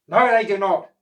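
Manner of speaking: loud, sharp cross reply. Acted. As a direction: snap back at the octopus. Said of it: "said Oscar crossly".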